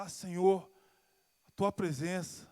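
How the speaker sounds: normal talking voice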